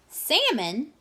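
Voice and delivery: high, loud squawk of delight; screech. A narrator's reading. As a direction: screech the word. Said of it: "screeched the pelican".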